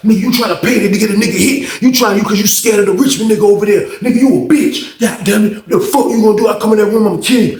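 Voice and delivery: high-pitched heated rant, acted out; retold angry threat. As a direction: imitate an inmate cussing someone out. Acted threats in prison quarrel.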